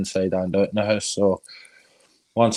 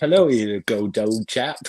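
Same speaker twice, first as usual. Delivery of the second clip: slow, plummy upper-class English hello; posh accent; slower than usual. Pretend. Pub landlord mocking himself with a slow, hammed-up posh English accent. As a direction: play the toff, drawing it out. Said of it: "That's your posh accent".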